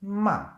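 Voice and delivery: loud "Ma" starting high and dropping sharply; fourth tone, falling. Shown as a demonstration. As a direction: hit "Ma" high, then let it fall. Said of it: "starts high and it goes down".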